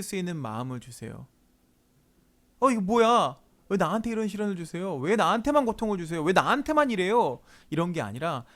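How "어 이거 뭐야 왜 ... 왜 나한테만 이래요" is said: higher-pitched, aggrieved protest; mock whining protest, raised pitch; indignant complaint. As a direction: complain as if unfairly singled out. Acted complaint to God, then rejected.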